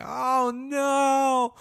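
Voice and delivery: high, loud cry of horror; mock the mother screaming in panic; panicked scream. The mother seeing the blood, a high loud panicked cry. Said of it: "She started screaming".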